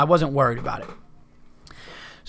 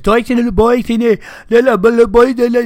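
much higher, louder mock gibberish imitating loud neighbours; 10 semitones higher, 10 dB louder than usual. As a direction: parody the noisy Spanish talk next door. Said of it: "talking Spanish in their room".

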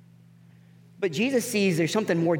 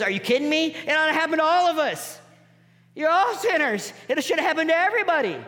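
incredulous; 9 semitones higher than usual. Retold or imitated. Jesus answers a question incredulously.

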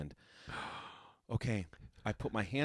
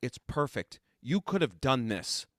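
exasperated scolding; 4 semitones higher, 5 dB louder than usual. Retold or imitated. Teacher scolds capable student.

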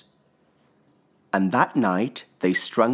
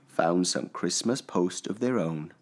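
ordinary delivery, nothing marked

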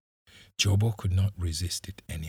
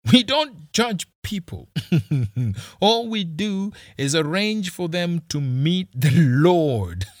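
very high, loud laughing threat; laughing loudly; 9 semitones higher, 7 dB louder than usual. Acted. Kisii laughing loudly as he mocks the boys' fate. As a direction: laugh through the cruel joke. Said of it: "He laughed loudly".

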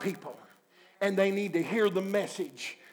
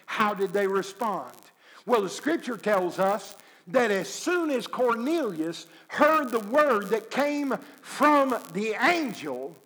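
usual speaking voice

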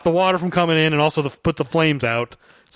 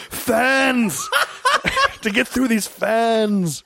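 British Keanu; 6 semitones higher, much slower than usual. Retold or imitated. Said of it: "a [name] impression".

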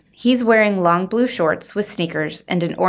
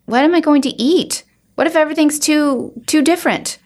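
higher, worried plea; anxious pleading; 9 semitones higher, 3 dB louder than usual. Acted. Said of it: "But Dad".

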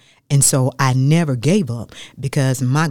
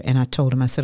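ordinary talk, nothing marked

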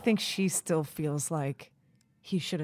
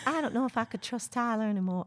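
higher-pitched imitation of a Long Island accent; Sam's Long Island accent; 5 semitones higher than usual. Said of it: "I'm do-, doing the accent".

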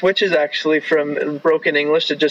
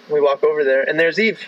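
ordinary delivery

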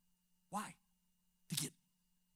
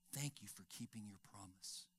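soft thanks; 8 semitones lower, 13 dB quieter than usual. Retold or imitated. Granddaughter's quiet thanks retold.

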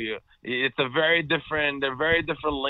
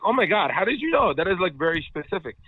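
high-pitched retold astonishment; amazed; 6 semitones higher than usual. Traveler amazed a sales rep spotted Brooklyn, surprised. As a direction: gush in disbelief, pitch jumping up.

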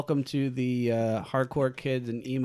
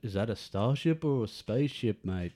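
put-on Liverpool accent. Co-host posing as director's pal asking about starships, Liverpool accent. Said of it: "I do mine from the poo".

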